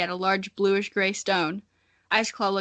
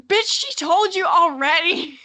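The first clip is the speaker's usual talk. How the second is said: louder, higher, slow, fed-up shout; exasperated outburst; 9 semitones higher, 7 dB louder than usual. Real, not acted. Author scolding her own heroine, exasperated yell. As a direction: yell at the character for not listening. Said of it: "exasperated outburst at her story".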